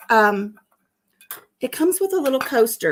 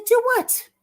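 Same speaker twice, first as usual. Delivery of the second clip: high, innocent "Do what?"; acting clueless; feigned innocence; 13 semitones higher than usual. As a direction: play dumb, pitch way up. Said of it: "I'm acting like I have no idea".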